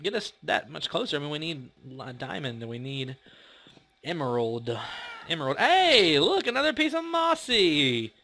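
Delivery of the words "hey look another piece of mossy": high, loud burst of delight at a find; sudden gleeful high cry; excited. Real, not acted. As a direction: squeal it like you found treasure. Excited find while mining.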